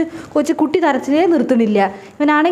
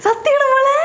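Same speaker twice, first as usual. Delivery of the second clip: high, delighted gasp of disbelief; mother-in-law thrilled at her daughter-in-law's pregnancy news, excited high voice; overjoyed; 9 semitones higher, slower than usual. Acted. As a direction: squeal with joy at the news.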